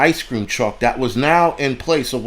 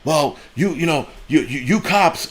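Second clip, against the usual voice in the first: higher-pitched imitation of a stammering man; mock stammer; 4 semitones higher than usual. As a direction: imitate him tripping over his words. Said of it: "now you're starting to stammer".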